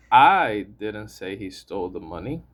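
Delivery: "I" punched at the start, the rest lighter; strong opening "I", then falling away; stress on I. Shown as a demonstration. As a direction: hit the word "I" hard. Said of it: "If I stress the word "I".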